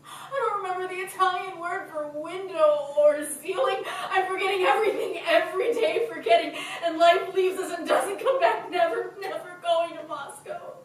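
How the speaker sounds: high, loud, fast, half sobbing, half laughing; tearful outburst. Acted. Sister sobs to her sister over lost life, high and tearful. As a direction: pour it out through tears. Distraught outpouring, cry heard.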